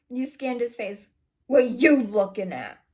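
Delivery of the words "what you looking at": loud, slow, moody challenge. A narrator's reading. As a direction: throw it out slowly, in a huff. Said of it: "Ron says moodily".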